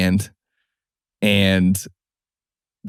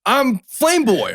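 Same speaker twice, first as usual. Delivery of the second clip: much higher and slow, hammed-up kid; kid's nickname intro; slower than usual. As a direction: stretch it out like a proud teen. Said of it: "the other kid's like".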